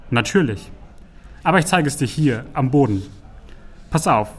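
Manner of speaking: ordinary delivery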